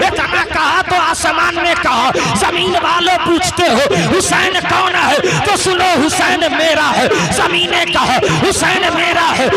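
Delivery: loud, high, ringing sermon climax; fiery preaching. Acted. Preacher roaring to the gathering, loud and high. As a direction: preach it out to the crowd at full force.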